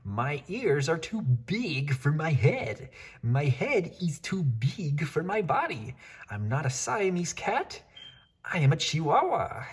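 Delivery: put-on kitten's slow, high Spanish accent; proudly in his best Spanish accent. Kitten posing in the mirror in a Spanish accent. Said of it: "using his very best Spanish accent".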